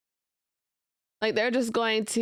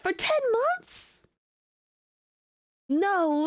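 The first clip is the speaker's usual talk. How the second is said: high, rising gasping disbelief; shocked; 7 semitones higher than usual. Real, not acted. Reading chat news, shocked the streamer went unpaid. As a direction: gasp at each new revelation.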